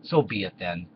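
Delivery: ordinary talk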